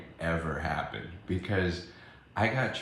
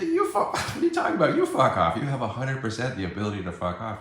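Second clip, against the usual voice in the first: high, loud, laughing outburst; heated comeback; 5 semitones higher, 6 dB louder, faster than usual. Retold or imitated. Retelling a quarrel with an attendee, indignant and loud. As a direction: throw it back loudly in mock outrage. Acted quarrel, throwing insult back.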